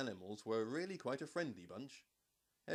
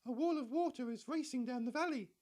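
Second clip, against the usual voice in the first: very high, squeaky and a bit louder; squeaky giraffe; 12 semitones higher, 4 dB louder than usual. A narrator's reading. Giraffe warning the animals, high and squeaky. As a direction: squeak it out like an alarmed little giraffe. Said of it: "in a surprisingly squeaky voice".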